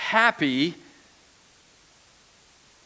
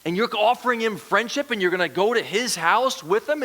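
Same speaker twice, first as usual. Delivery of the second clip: mock-indignant complaint, pitched up; higher-pitched, incredulous crowd grumbling; grumbling and complaining; 6 semitones higher than usual. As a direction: protest like an affronted onlooker. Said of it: "so they're grumbling and complaining".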